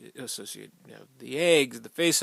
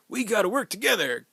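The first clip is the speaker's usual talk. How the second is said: a higher, louder mock yell of a doomed hero; mock shouted plea; 9 semitones higher, 7 dB louder than usual. Movie character yelling to the group mid-speech, mock shout. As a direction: shout it like the guy about to be eaten. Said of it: "where he's like".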